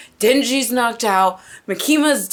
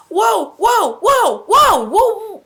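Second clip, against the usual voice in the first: high, loud stunned whoas; shocked whoa; 9 semitones higher, 5 dB louder, slower than usual. Real, not acted. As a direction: gasp it out in real shock. Shocked reaction to anime scene.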